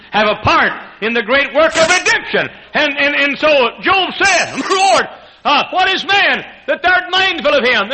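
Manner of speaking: high, loud climax with a shouted Lord; fervent preaching. Acted. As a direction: lift the room with it.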